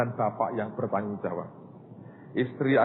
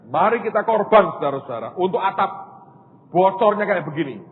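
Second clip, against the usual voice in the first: louder, higher rallying the committee; rallying call; 6 semitones higher, 7 dB louder than usual. Retold or imitated. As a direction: fire up the room for the offering. Pastor rallying committee for offering.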